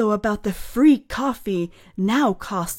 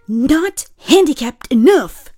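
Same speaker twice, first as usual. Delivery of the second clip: mock indignant voice; 6 semitones higher, 9 dB louder, slower than usual. Mocking voice with laugh.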